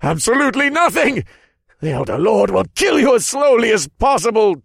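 high, loud furious threat; spitting fury. A narrator's reading. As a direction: spew it at full voice. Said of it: "the general spat".

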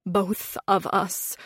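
soft, slow and shaken; trembling. A narrator's reading. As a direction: say it softly, stunned. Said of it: "I trembled".

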